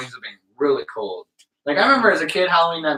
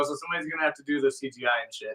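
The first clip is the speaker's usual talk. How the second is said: ordinary talk, nothing marked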